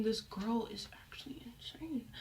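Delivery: hushed, voiceless aside; whispered thought. Retold or imitated. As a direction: whisper it in disbelief to yourself. Inner thought while reading her texts.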